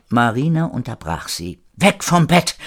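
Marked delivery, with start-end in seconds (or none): 1.7-2.6 higher urgent command; sharp order. Acted. Marina interrupting at Erik's bedside, sharp order. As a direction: cut in and order them back.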